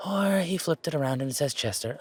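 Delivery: ordinary talk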